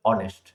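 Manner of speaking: অনেস্ট said with no h, starting on the vowel "on-est"; silent h in honest. Shown as a demonstration. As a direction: drop the h in অনেস্ট completely. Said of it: "এটা actually হনেস্ট না".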